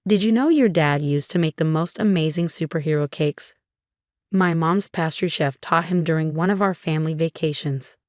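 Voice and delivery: plain reading voice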